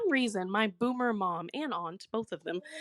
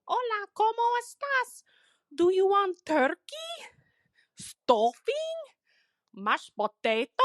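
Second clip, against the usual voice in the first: high-pitched, badly faked Spanish accent; horrible fake Spanish accent; 12 semitones higher than usual. Mom hosting the new mother-in-law, horrible fake Spanish accent. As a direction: imitate mom's awful put-on Spanish accent. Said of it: "in this horrible fake Spanish accent".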